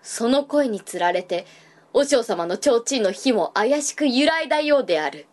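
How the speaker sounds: ordinary delivery, nothing marked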